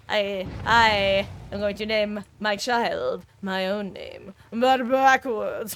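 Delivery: put-on slow, lightly done French accent on the plan; a playful French accent, stretched slowly; mock French accent. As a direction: drawl it out in a hint of French accent. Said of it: "I don't know why I got French for that".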